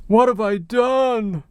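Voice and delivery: slow, hammed-up despairing wail; mock anguish. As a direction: play it as melodramatic remorse.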